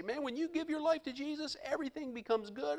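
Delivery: high, light mock preacher voice; high mock glib preacher. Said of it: "preachers say".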